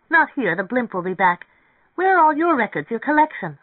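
high, loud, eager character voice; eager young woman pressing her idea; excited woman character. Acted. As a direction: voice her bubbling with impatience. Narrator voices excited Mary Ann.

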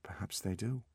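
quiet, distracted reply; absent. A narrator's reading. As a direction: say it absently, half to yourself. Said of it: "said Artemis absently".

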